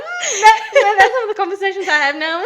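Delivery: high, fast, laughing through the words; giggling at her own posh new talk; laughing self-mockery. To the host, laughing about new conversations. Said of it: "I'm like".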